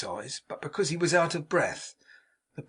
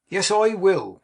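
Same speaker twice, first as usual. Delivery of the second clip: higher and louder, bursting out; joyful cry; 6 semitones higher, 8 dB louder, slower than usual. A narrator's reading. Mark greets the hostess he loves, crying out. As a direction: cry it out in glad reunion. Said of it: "cried Mark".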